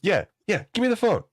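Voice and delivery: high-pitched imitation of the coworker. Teller mimics Nigerian coworker asking about the call, high mock voice. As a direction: pitch way up to play him.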